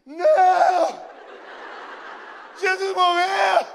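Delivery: high, very loud, drawn-out wail of horror; hammed-up scream. Two friends reacting together to the priest's news, screaming. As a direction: scream it out slowly in mock anguish. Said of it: "a gente gritou junto".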